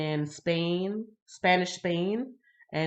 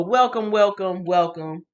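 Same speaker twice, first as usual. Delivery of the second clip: warm welcome; 7 dB louder than usual. Real, not acted. Welcoming a late viewer.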